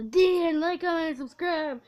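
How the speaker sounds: loud, burped out; talking while burping. Real, not acted. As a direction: push the words out on a burp. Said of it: "my stomach is all messed up now".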